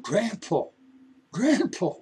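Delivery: put-on boy calling to his fallen grandpa; alarmed call. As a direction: call out in sudden fear. Boy sees grandpa collapse.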